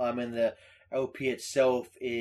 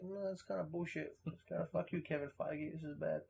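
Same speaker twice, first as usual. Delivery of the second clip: quiet, slightly raised aside; muted grumble; 3 semitones higher, 15 dB quieter than usual. Retold or imitated. Friend recalls objecting to the film, quiet grumble. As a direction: grumble it under your breath.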